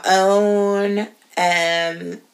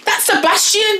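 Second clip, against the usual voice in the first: high excited correction; 12 semitones higher than usual. Real, not acted. Friend insists eagerly on the Disney crab, high and excited. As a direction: insist eagerly on the crab's name.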